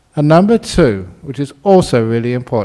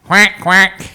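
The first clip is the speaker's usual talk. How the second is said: higher, quick imitation duck quack; 7 semitones higher, 3 dB louder, slower than usual. Storyteller imitating the duck the couple heard, quacking. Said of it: "they heard a sound".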